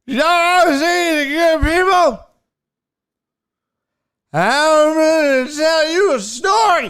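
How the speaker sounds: high, loud, slow slurred yelling; mad drunk heckler. Retold or imitated. Drunk heckler argues with the comedian, angry slurred shouting. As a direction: drag the words out in a drunken rage. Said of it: "Now the dude's getting mad".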